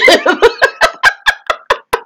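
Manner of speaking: high drawn-out laugh; real amused laughter. Real, not acted. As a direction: laugh it off at length. Laughing at the guy's defensiveness.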